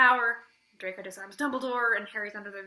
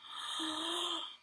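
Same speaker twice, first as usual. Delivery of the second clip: gasp; 6 semitones higher, 10 dB quieter than usual. Retold or imitated. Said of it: "I was like".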